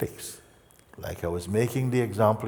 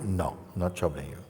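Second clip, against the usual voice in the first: normal talking voice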